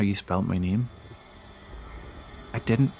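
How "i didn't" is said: ordinary delivery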